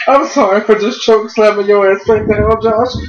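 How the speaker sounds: high, mock-sweet sorry, Josh; sarcastically sing-song the apology like a polite monster; mock Kane apology. Mocking Kane after choke slam.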